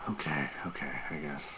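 hushed reluctant agreement; whispered grudging okay. Real, not acted. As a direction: whisper it back. Private chat continues.

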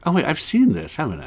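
put-on puzzled viewer; dawning recognition. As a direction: act out suddenly remembering. Voicing viewer recognizing the movie.

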